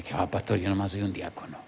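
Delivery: low, soft self-effacing answer; hushed, modest denial; humble deacon's reply. Retold or imitated. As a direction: shrug it off quietly.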